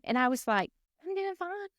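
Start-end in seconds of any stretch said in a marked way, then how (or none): 1.1-1.7 squeaky high, much softer "I'm doing fine"; high, soft reply. Retold or imitated. Retold reply to fussing employees, high and soft. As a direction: tight little falsetto answer, held in.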